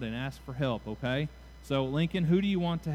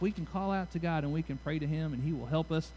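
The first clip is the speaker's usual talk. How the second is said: ordinary delivery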